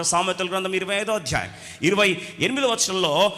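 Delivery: usual speaking voice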